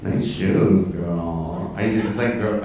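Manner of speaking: usual speaking voice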